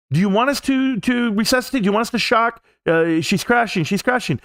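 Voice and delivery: high, urgent, panicked questions; panicked vet staff. Retold or imitated. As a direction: rush it out like a frantic vet tech shouting mid-emergency. Vet staff running out, dog crashing.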